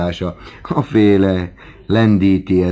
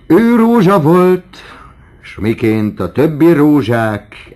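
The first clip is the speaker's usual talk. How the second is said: high, loud, slow recitation; declaimed verse; 10 semitones higher, 9 dB louder, slower than usual. Acted. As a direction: declaim the poem grandly. (magas, hangos, lassú szavalás)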